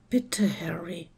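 whispered plea; quiet plea. A narrator's reading. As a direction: whisper it softly, pleading. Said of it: "bat seine Freundin leise". (geflüstertes Bitten)

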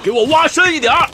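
high-pitched call to the diggers; rally the men like a drill leader; shouting slogans. Acted. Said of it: "你现在都会喊口号了". (高声喊口号给大家鼓劲)

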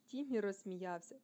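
ordinary delivery

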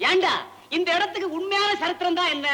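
high-pitched outburst at a friend; yelling. Acted. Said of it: "ஏண்டா, ஏண்டா கத்துற?". (கத்திக் கேட்பது)

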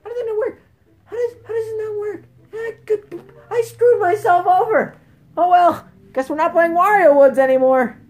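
high-pitched exasperated complaint; high gamer whine rising to a shout; frustrated outburst. Real, not acted. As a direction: whine in disbelief, building to a loud outburst. Frustrated after failing the game.

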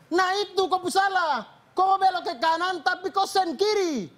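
Obed scolding Minggus about his turn signal; scolding accusation. Retold or imitated. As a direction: drive home that he is wrong.

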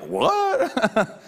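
high-pitched, dumbfounded teacher's "what". Retold or imitated. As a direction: imitate a baffled teacher, voice shooting up. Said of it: "they were all kinda dumbfounded".

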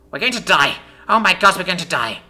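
high, loud panicked wail; falling girl shrieking in panic at her friend; freaking out. Acted. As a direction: lose it completely, shouting in fear. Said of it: "as she freaked out".

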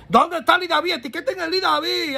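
ordinary talk, nothing marked

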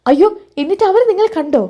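high, panicky gasp of alarm; high-pitched alarmed question. Acted. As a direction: ask it in sudden dread. Anamika fears being found out.

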